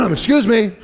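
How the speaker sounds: mock-polite attention getter; playful Jesus. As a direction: butt in after a throat-clear.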